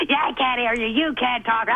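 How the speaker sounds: put-on bully's jeer; jeering taunt. Nellie torments a sobbing stuttering girl, jeering taunt. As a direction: taunt her like a nasty schoolgirl.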